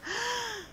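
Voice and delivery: high, breathy gasp of disgust. Acted. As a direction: react as if the smell hits you. Narrator reacts to the stinking pot. (exclamación de asco)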